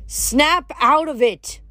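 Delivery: high, drawn-out exasperated yell; slow, high-pitched shout. A narrator's reading. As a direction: yell to break their trance. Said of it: "she yelled".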